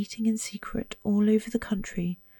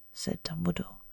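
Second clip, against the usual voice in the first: ordinary delivery, nothing marked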